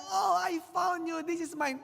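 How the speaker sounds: put-on boy spotting his lost boat in a shop, overjoyed shout; joyful shout. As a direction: burst out as if finding a lost treasure.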